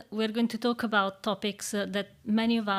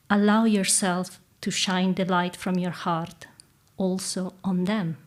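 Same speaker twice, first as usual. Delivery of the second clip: calm meditative tone. Acted. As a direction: guide them gently into stillness. Leads a guided reflection.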